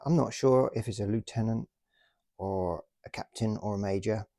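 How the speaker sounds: normal talking voice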